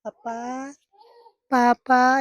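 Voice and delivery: put-on high, sweet little-girl voice calling papa; imitating the daughter lovingly. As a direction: copy the toddler's tender call. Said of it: "बड़े प्यार से कह रही थी".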